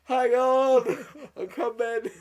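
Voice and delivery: high, loud crying wail; hammed-up sobbing shout to someone at the door; mock tearful call. Film fan interrupted crying by delivery man, mock tearful call. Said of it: "absolutely crying. And then the fucking Tesco's delivery man turns up".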